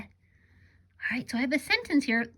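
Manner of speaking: ordinary talk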